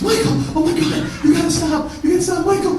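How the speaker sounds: high, loud imitation of a frantic wife; panicked pleading wife. Wife running after her husband, pleading frantically.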